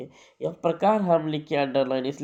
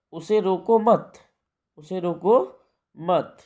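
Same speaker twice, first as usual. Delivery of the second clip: उसे रोको मत as one phrase, higher on रोको; pause after मत; 4 semitones higher, 4 dB louder than usual. Shown as a demonstration. Teacher giving students the opposite meaning, मत joined to रोको. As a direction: run रोको straight into मत, then stop. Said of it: "के बाद coma है".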